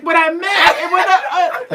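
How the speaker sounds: mock flustered stammer, dragged out; stumbling. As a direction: imitate a man fumbling to explain himself. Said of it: "stumbling in front of his voice".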